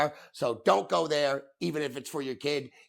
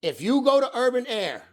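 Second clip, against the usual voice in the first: higher, louder repeat for emphasis; said again, louder; 9 semitones higher, 6 dB louder than usual. Real, not acted. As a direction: hammer it home a second time. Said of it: "I'm gonna say it again".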